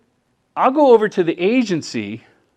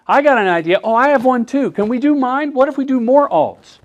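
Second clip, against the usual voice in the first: higher, quick eager voices; eager pitching; 8 semitones higher, 3 dB louder than usual. Retold or imitated. Agency folks on set each pushing their own idea, excited and high. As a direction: everyone jumping in with an idea. Agency people crowding in with ideas.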